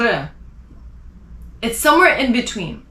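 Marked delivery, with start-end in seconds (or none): 0.0-0.3 r made too far back; wrong R. Shown as a demonstration. Teacher showing the other wrong R. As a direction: push r right to the end. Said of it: "it's not r".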